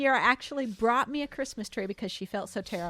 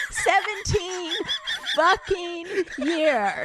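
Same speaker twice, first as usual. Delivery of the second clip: drawn out, each word leaned on; slow emphatic complaint; 5 semitones higher, much slower than usual. Real, not acted. Wife griping to friends about years of waiting, slow and emphatic. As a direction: stretch it out, milking the grievance.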